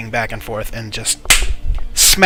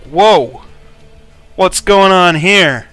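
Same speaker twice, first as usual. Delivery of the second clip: loud, high, drawn-out astonishment; alarmed surprise; 6 semitones higher, 9 dB louder, much slower than usual. Acted. Guard captain turning round at the smack, loudly startled. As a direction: demand an explanation, taken aback.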